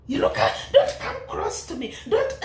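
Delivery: breathy laugh mocking the words; laughing as she mimics; breathy laugh of mockery. Real, not acted.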